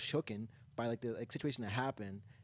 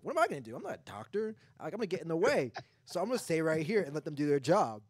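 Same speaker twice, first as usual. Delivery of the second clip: retold protest; 4 semitones higher, 5 dB louder than usual. Retold friend refusing to step in.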